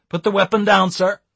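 loud, high officer's shout; shouted order. A narrator's reading. Officer ordering Eric to drop the weapon, shouted. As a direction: shout it as a police command. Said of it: "shouted the officer".

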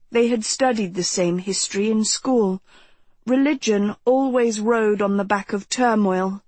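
ordinary delivery, nothing marked

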